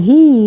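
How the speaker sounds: a high, loud knowing hmm; knowing hum. Retold or imitated. Wife signals her husband with a high, loud knowing hmm.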